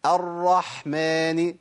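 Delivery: slow chanted "الرحمن" with full audible h; repeat-after-me model. Shown as a demonstration. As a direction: draw out "الرحمن", sounding the ح. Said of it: "جرب بقى كده وقول ورايا".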